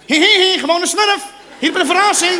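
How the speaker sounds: high, loud little cartoon-creature voice; hammed-up high smurf. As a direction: pipe up like a gleeful smurf. Acts out a smurf from the forest.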